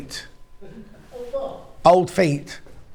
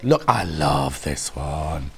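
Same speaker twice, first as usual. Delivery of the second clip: low, slow and gruff; 12 semitones lower, 4 dB quieter, much slower than usual. Real, not acted. Host admits to viewers her voice has gone gruff. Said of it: "I've gone very, very gruff this morning".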